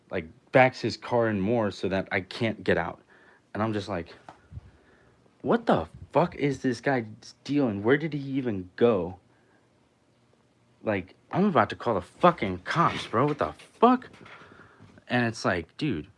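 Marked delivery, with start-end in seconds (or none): 5.4-14.1 louder, incredulous outburst; exasperated disbelief. Retold or imitated. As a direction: re-enact fed-up bafflement at the blocker. Retold disbelief at being blocked in.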